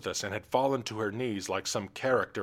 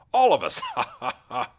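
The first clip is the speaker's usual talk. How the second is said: loud high cackle; forced royal laugh; 4 semitones higher, 8 dB louder than usual. Acted. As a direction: laugh to cue the court. King cues laughter.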